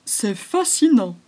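slow, loud marvelling; fascinated exclamation. Acted. Woodpecker Cousteau lands by the snake, slow and marvelling. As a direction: savour the wonder. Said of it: "s'exclama Cousteau le pic noir".

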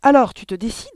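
loud, high, impatient prodding; insistent. A narrator's reading. Mother pressing her reluctant husband, loud insistence. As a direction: press him hard to move. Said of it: "insista la mère".

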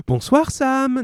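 bright, high, happy hello; cheerful greeting. A narrator's reading. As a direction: greet the grocer gladly. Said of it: "dit-elle joyeusement".

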